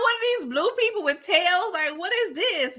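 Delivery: high, incredulous squeal at the screen; baffled disbelief. Retold or imitated. Wife retelling watching Avatar, mock baffled squeal. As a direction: play it like she can't believe blue people have tails. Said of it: "I was like".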